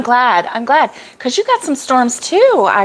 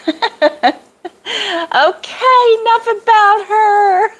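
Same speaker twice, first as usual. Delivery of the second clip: playful singsong; 12 semitones higher than usual. Pretend. Speaker cuts off her own gushing praise, playful high singsong. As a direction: sing it out high and teasing. Breaks off praise of someone, laughing.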